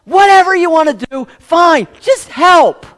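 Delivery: a desperate plea, yelled at the sky; loud cry of giving up, calling to God. Retold or imitated. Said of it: "we cry out to God and we say".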